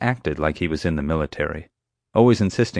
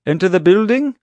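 loud, high rising question; feigned mishearing; 13 semitones higher, 8 dB louder than usual. Pretend. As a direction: shout it back as an innocent question. Pretends to mishear the order.

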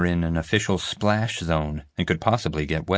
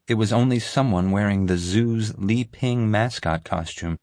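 ordinary delivery, nothing marked

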